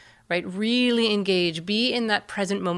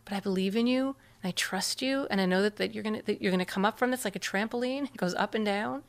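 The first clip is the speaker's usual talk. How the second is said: soft, gentle and quieter; trusting reassurance; 5 dB quieter than usual. Shown as a demonstration. Parent showing trust to a sad child, softly. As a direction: calmly show faith in the child. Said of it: "there's a look on your face".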